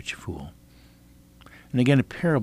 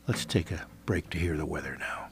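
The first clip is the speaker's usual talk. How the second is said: whispering; 4 semitones lower, 9 dB quieter than usual. Real, not acted. Quiet handoff to break.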